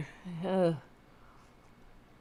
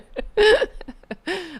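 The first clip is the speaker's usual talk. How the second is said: burst of laughter; 13 semitones higher, 5 dB louder than usual. Real, not acted. Laughs at own digression.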